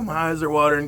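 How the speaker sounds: ordinary talk, nothing marked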